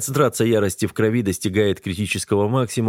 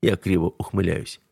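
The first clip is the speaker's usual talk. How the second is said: ordinary delivery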